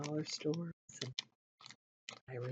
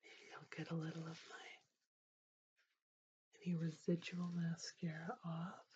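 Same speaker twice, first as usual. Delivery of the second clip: ASMR whisper; 2 semitones higher, 9 dB quieter than usual. Acted. ASMR host to viewer, dabbing Kleenex, soft whisper. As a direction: whisper gently to lull the viewer.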